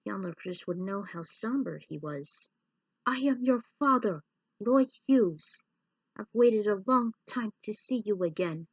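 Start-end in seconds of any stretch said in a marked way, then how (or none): 3.1-8.7 a lightly voiced robot father; revealing father. Roy telling his son he is his father, solemn and louder.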